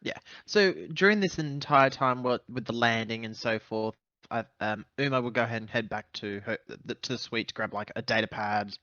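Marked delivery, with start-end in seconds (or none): none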